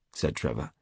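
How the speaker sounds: ordinary delivery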